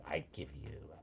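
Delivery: hushed, low whisper; whispered reply. Retold or imitated. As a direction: imitate an actress's secretive whispered line. Imitates the actress's reply.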